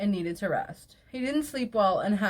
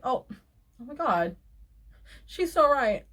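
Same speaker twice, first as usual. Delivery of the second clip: rising, louder pretend epiphany; mock sudden realisation; 4 semitones higher, 4 dB louder than usual. Mocking the partner suddenly agreeing, feigned amazement. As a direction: play his fake revelation sarcastically. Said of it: "this time be like".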